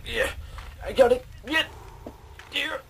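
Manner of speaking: gleeful little yells; mock triumphant cheer. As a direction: imitate the character's over-the-top victory yelps. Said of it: "It's like".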